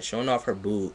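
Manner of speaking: usual speaking voice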